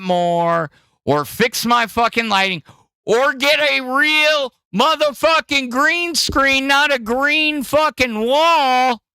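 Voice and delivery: real yelling about the green screen. Real, not acted. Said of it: "Didn't mean to yell".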